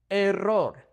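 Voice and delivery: Error as eh-RROR with rolled r; Spanish "Error", trilled middle r; Spanish pronunciation. Shown as a demonstration. Teacher models the word, Error with rolled r. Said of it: "with the word error".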